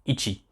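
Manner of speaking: "ichi" softly, as ee-chee; Japanese pronunciation. Shown as a demonstration. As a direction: model "ichi" quietly as a Japanese reading. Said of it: "une de ses prononciations en hiragana".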